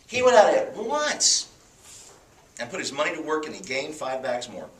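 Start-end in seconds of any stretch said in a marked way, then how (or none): 0.1-1.5 once drawn out high and slow; "went out at once" lifted and stretched; stress on once. Shown as a demonstration. Preacher to the congregation, stressing once. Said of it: "underline the word once".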